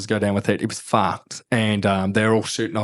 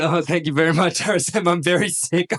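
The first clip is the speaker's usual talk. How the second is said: higher, hammed-up feeble thanks; pathetic sick voice; 6 semitones higher than usual. Feverish lieutenant thanking the RSM, mock feeble. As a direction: play it pitiful and woozy.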